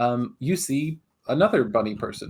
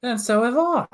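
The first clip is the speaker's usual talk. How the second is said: higher and louder comeback; match him stubbornly; indignant retort; 11 semitones higher, 6 dB louder than usual. Acted. Bunny double answering the player, stubborn. Quarrel between twins.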